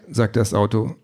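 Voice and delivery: ordinary delivery, nothing marked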